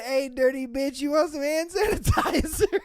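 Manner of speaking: high-pitched taunt dissolving into laughter; amused retelling, voice climbing; quoting through laughter. Retold or imitated. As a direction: repeat the insult while cracking up. Repeats quote, breaking into laughter.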